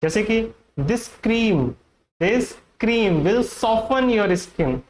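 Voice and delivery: ordinary delivery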